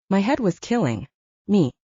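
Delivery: usual speaking voice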